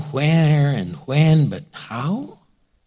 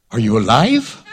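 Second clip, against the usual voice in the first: laughing question; 6 semitones lower than usual. Retold or imitated. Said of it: "Sarah's like".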